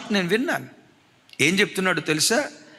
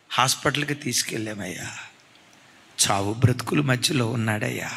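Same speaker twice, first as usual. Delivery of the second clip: softer, lower grief; grieving mother; 7 semitones lower, 4 dB quieter than usual. Retold or imitated. Mother telling the preacher her husband is dying, soft and low. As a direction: tell it low and broken. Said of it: "భార్య వచ్చి చూస్తే".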